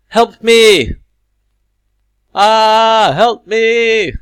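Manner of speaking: high, very loud acted scream; hammed-up panicked shouting; mock cry for help. Teacher reads example one to pupils, mock screaming for help.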